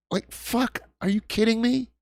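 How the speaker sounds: high-pitched exasperated disbelief; incredulous, agitated outburst; worked up. Real, not acted. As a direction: voice jumps up in real outrage. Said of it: "I'm getting worked up".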